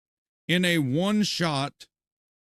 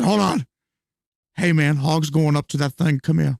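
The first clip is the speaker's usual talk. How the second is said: imitating a developer calling out, louder; urgent mock call to a coworker, louder; developer calling a colleague; 4 semitones lower, 5 dB louder than usual. Developer calls a colleague over to watch, loud mock urgency. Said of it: "Chris Wilson's sitting there like".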